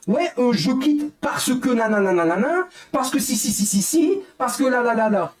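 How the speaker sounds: mock his excuses in a high taunting voice; mocking quote. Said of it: "il commence à dire".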